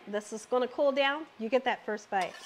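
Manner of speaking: usual speaking voice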